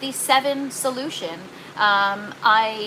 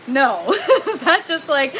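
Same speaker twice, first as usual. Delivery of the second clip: real laughter; 4 semitones higher, slower than usual. Real, not acted. Answering a cheeky chat message, laughing. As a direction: laugh while refusing.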